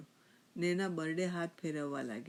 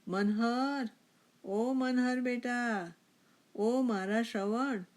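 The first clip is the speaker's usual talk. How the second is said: slow, drawn-out loud call; father hailing his son, raised pitch, stretched names; 6 semitones higher, 5 dB louder, much slower than usual. A narrator's reading. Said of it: "બાપાએ બૂમ પાડી".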